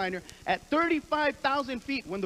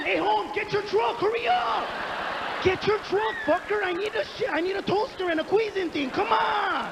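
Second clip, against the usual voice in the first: urgent phone call; 6 semitones higher than usual. Retold or imitated. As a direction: hurry your friend on the phone. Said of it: "I was on the phone".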